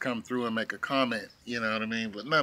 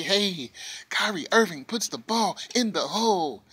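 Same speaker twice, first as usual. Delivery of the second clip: normal talking voice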